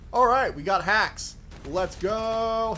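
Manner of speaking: excited. Real, not acted. Hyped gaming moment.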